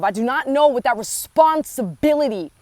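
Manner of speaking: ordinary talk, nothing marked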